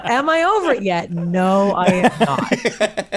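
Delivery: mock-dramatic admission, drawn out at the end; emphatic grudge. Real, not acted. Guest admitting she still resents the awards, emphatic. Comic rant about old grudge.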